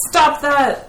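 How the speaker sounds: high, loud shout of command; yell. Acted. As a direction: yell it out to halt someone fleeing. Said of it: "I'll yell".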